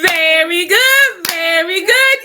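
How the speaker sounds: high, loud and slow delighted praise. Real, not acted. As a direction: cheer her on, thrilled. Delighted praise after game.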